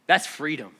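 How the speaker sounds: ordinary talk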